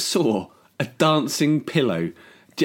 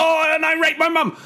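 high, sudden panicked confession; panicked blurt; 13 semitones higher, 3 dB louder than usual. Retold or imitated. Guest blurts under silent stare, high panicked blurt.

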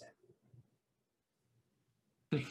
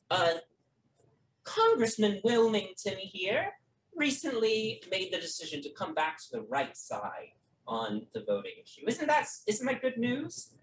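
lightly voiced politician; 7 semitones higher than usual. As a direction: gloat politely about the good news. Lance boasts, Herb replies.

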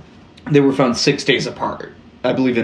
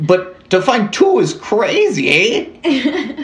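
slow, higher Canadian voice, the eh rising high; bad Canadian accent; 11 semitones higher, slower than usual. Host quotes the Mountie's joke, in a bad Canadian accent. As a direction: deliver the punchline like a corny Mountie. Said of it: "read this in a bad Canadian accent".

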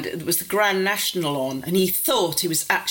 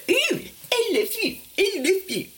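high mocking singsong, much higher; mocking father. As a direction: mimic a father teasing in a high silly voice. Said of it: "he just went".

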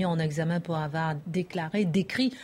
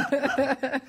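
laughing; 7 semitones higher than usual. Real, not acted. Laughter continues.

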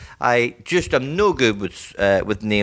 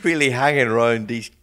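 laughing through the words; enjoying the awkwardness, laughing; 3 semitones higher than usual. Real, not acted. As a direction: giggle while telling it. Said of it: "I'm loving this".

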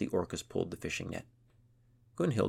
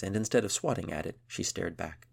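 plain reading voice

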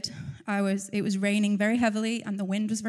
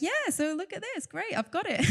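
high, self-satisfied chirp; mocking her own overconfidence, high and pleased; mock smug; 9 semitones higher than usual. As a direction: play it as smug and breezily triumphant. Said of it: "I was coming to share this and be like".

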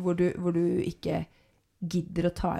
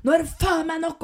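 higher-pitched, emphatic put-on fury; acted angry cursing; 8 semitones higher, 3 dB louder than usual. Man putting his foot down in an argument, acted angry cursing. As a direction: curse it out as if banging the table. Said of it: "en mann slår hånda ned".

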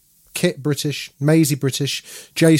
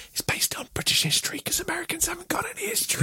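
a fast, sneaky stage whisper; conspiratorial whisper; 10 dB quieter, faster than usual. Acted. Podcast host whispering a cheeky dig at Americans. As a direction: whisper the dig like a naughty secret.

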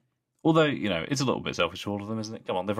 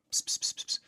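soft unvoiced psst psst to lure a cat; whispered kitty call; 4 dB quieter than usual. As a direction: hiss quietly like coaxing a cat. Calling a cat.

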